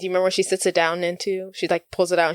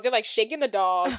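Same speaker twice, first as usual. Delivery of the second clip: ordinary talk, nothing marked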